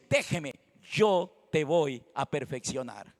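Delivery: low, slow, calming divine promise; gentle reassurance. Acted. God reassuring the weary believer, gentle and slow. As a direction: drop low and unhurried, reassuring. God's gentle words after loud preaching.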